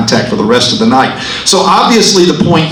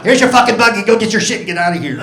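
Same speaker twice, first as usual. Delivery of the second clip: bossy wife; 6 semitones higher, much faster than usual. Retold or imitated. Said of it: "That's just my wife".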